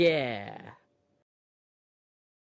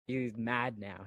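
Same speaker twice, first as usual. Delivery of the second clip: usual speaking voice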